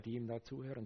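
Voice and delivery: normal talking voice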